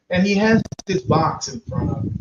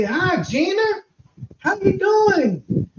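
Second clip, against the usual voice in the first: put-on high, slow, sweet old lady voice; great-grandmother greeting; 11 semitones higher, slower than usual. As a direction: greet her slowly and kindly, way up high.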